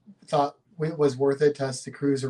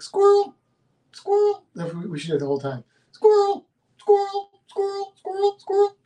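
shrill, high yelp of "Squirrel!"; high squeaky squirrel call; 5 dB louder than usual. Pretend. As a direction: blurt it out like a distracted dog. Shouting Squirrel like distracted dog.

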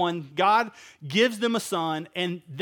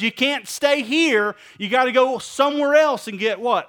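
urgent command; 5 semitones higher, 6 dB louder than usual. Retold or imitated. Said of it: "Abraham say? He goes".

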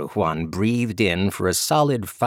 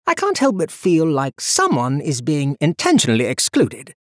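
higher, teasing nobleman voice; playful Archlord; 7 semitones higher, 4 dB louder than usual. Acted. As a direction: needle them with a grin. Ethan's voice, playful.

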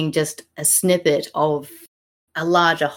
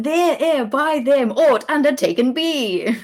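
sneering at the line, pitched up; mocking quote; 7 semitones higher than usual. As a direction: poke fun at the clumsy verse. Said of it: "infuriating to read out loud".

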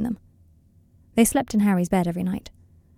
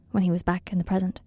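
ordinary delivery

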